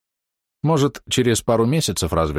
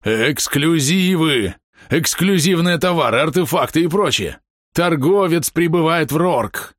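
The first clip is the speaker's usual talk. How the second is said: excited yelling; 9 semitones higher, slower than usual. Retold or imitated. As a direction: shout the news like a herald. Friend yelling about merchant.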